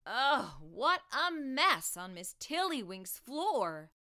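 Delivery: ordinary delivery, nothing marked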